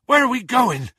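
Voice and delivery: high, loud winded question; puffing. A narrator's reading. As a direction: call it out while out of puff. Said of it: "puffed Hagrid".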